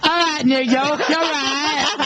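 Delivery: hammed-up London singer hailing the crowd. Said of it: "she goes".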